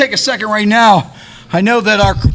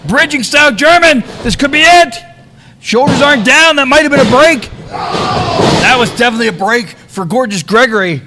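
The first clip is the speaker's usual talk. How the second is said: excited play-by-play; 5 semitones higher, 5 dB louder than usual. Real, not acted. Excited live play-by-play call.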